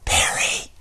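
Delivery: hushed, voiceless call; cousin's voice. Retold or imitated. Retelling a late cousin calling his name, hushed. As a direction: softly breathe the name, imitating her. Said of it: "I heard my cousin ... say".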